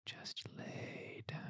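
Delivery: barely audible sleepy whisper; fading bedtime whisper; faint whisper. Acted. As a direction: breathe the words as he drifts off. Lulling baby to sleep.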